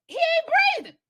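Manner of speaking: high, loud cry of alarm; panicked shout. Retold or imitated. Onlookers see he stopped breathing, panicked shout. Panicked crowd in retold scene.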